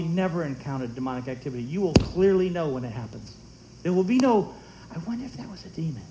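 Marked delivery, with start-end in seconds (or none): 4.9-6.0 soft, quick aside in a doubter's voice; hushed wondering. Acted. Preacher mimicking someone unsure about a demon, hushed wondering. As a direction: toss it off softly, like musing to yourself.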